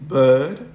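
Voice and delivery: bird with no R sound. Shown as a demonstration. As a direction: drop the R in bird, non-rhotic. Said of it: "if you listen to the words".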